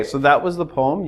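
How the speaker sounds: ordinary delivery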